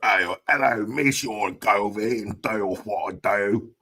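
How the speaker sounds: exaggerated mock London chat-up; unattractive London accent. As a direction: try flirting in an ugly London accent. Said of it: "one of the most unattractive accents in the world".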